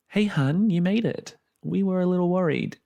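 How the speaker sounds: sweet friendly voice, up high; friendly woman voice. Acted. Larissa greets her friendly.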